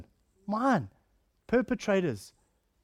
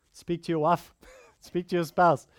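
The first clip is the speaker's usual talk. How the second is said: ordinary delivery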